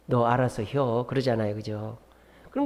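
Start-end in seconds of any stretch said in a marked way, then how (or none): 0.1-1.0 slow, low, offhand Chungcheong drawl; Chungcheong dialect. Retold or imitated. Chungcheong customer telling the seller to decide, slow low dialect. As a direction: lightly mimic a laid-back Chungcheong elder. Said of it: "충청도 분은".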